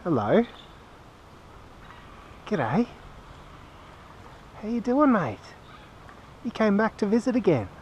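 high, sweet voice for the bird; pet talk. Real, not acted. Man welcomes the returning kookaburra, high sweet pet-talk voice.

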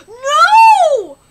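real panicked outcry; panicked shout. Real, not acted. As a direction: yell it in panic. Character about to die.

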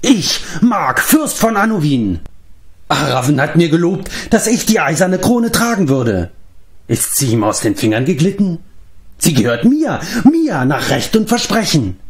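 high-pitched, megalomaniac rant; crazed bellowing. A narrator's reading. Mark claiming the promised crown, ranting high. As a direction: rant and claim what is yours. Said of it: "brüllte Mark".

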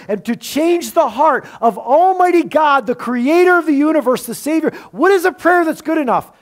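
impassioned preaching. Real, not acted. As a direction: preach it with mounting urgency. Preaches a rising rhetorical question.

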